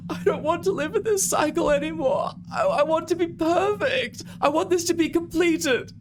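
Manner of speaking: very high, obstructed falsetto; high, strained monster-woman begging; impeded voice. Acted. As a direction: plead as if something hangs over your mouth. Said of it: "her voice is being impeded by the skin".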